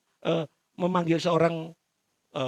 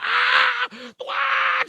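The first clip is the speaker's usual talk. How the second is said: high screaming cry of pain; 6 dB louder, much slower than usual. Acted. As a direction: wail in agony as he is cut. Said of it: "Bejo ini sudah teriak". (jeritan kesakitan sambil menangis)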